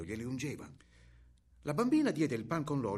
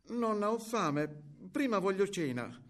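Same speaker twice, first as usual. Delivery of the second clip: put-on higher, lightly childlike plea; little girl voice; 5 semitones higher than usual. A narrator's reading. Hungry girl to grandmother wanting supper, higher child voice.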